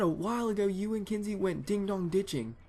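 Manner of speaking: high, emphatic retold dad voice; imitated dad. Dad explaining the charge to his kid, mock high-pitched accusing.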